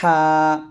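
হা thin with slight pressure; thin pressed ha. Shown as a demonstration. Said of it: "চিকন করে বলতে হবে".

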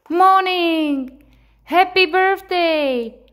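high, loud, drawn-out birthday cheer; excited storybook cheer; joyful exclamation. A narrator's reading. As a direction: call it out high, celebrating at last. Birthday finally arrives in story.

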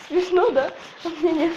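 usual speaking voice

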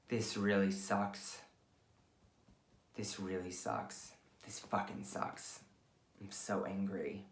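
quiet defeated self-talk, fading; low, deflated venting. Shown as a demonstration. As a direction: let the stress sink into a muttered low voice. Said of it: "your chance to vent".

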